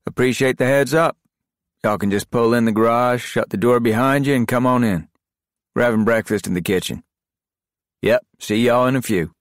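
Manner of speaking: high, loud easygoing Southern operator; relaxed phone voice of a soldier. Acted. Grayson on the phone.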